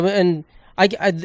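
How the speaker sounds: real stumbling restarts; stuttering. Real, not acted. Starting a gripe about scam texts, stuttering. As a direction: trip over the opening words, repeating them. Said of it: "I just stuttered so much there".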